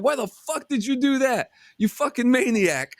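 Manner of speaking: high-pitched imitated yell at the driver; mock yell. Trainer yelling at the reckless driver, high mock shout. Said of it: "the trainer's screaming at him".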